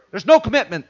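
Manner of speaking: ordinary talk, nothing marked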